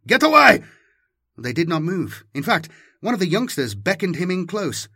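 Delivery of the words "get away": high, loud warning yell; shout. Acted. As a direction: bark it at the cadets. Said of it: "he shouted at them".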